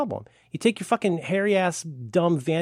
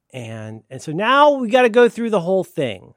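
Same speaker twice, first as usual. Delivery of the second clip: ordinary talk, nothing marked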